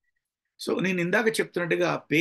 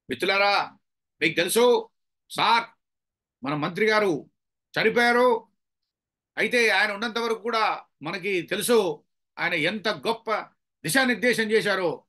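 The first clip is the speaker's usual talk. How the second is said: loud, high condolence speech in bursts; overdone shouting; 5 semitones higher, 3 dB louder than usual. Shown as a demonstration. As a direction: holler the tribute as if at a rally. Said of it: "అంటే ఇంత గట్టిగా అరవాల్సిన అవసరం ఏందండి". (గట్టిగా అరుస్తూ ప్రసంగం)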